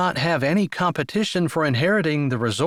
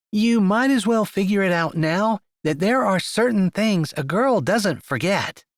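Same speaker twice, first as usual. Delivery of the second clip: put-on higher, teasing young woman voice; sassy young woman; 8 semitones higher than usual. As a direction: voice her like a sharp-tongued friend. Harper's lines, narrator voices woman.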